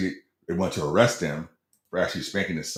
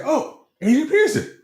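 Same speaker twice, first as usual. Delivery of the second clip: high, loud starstruck squeal, re-enacted; high-pitched voice; 5 dB louder than usual. Club worker recognizing a famous athlete, high-pitched excited voice. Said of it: "I must have my high-pitched voice going".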